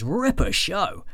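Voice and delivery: broad Australian review-title readout; Australian accent. Acted. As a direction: put on a hammed-up Aussie accent. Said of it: "where my Australian accent will end".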